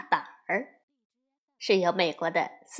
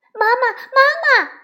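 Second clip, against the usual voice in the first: put-on high, loud child's cry for mother; little bear sister shouting; 14 semitones higher, 11 dB louder than usual. A narrator's reading. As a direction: call out urgently for mum, much higher and louder. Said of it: "大喊". (小熊妹妹高声大喊)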